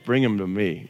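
low, soft and easy; calm gentle Jesus. Retold or imitated. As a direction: wave off the fuss, soft and low. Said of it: "Jesus is like".

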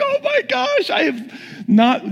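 very high, overwhelmed mock cry; imitated crying out. Retelling people getting healed, imitated crying out. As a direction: act out a high-pitched, astonished cry. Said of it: "They're crying".

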